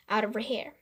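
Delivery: ordinary delivery, nothing marked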